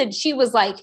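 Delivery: ordinary talk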